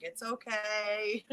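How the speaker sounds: slow, soft it's okay; gently forgive the child; slow soothing reassurance. Retold or imitated. Father forgiving the child's confession, slow and soft. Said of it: "And he's like".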